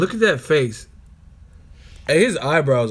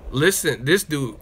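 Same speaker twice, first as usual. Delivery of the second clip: ordinary talk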